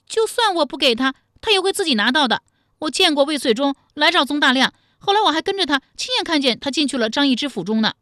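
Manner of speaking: high, loud, rapid self-defence; frightened captive. Acted. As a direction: gabble excuses up high to an angry elder. (高声急促地辩解)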